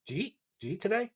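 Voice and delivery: jeet today said as one clipped question rising at the end; Philly jeet. Shown as a demonstration. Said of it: "We shorten it to jeet".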